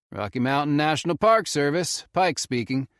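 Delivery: easy Southern ranger drawl. A narrator's reading. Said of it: "in his thick southern drawl".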